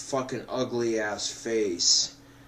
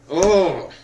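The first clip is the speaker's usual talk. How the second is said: much higher and louder exasperated yell; real exasperated shout at the camera; frustrated outburst; 5 semitones higher, 10 dB louder than usual. Real, not acted. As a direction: blow up at your own mishap.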